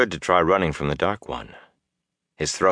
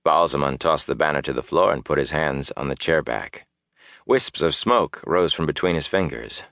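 ordinary delivery

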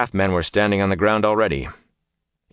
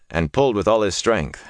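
plain reading voice

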